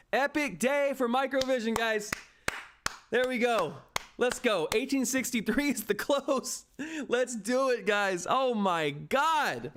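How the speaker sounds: high, giddy cheering at the close; whooping it up with the chat; ecstatic celebration. Real, not acted. Streamer cheering the stock close to his viewers, thrilled.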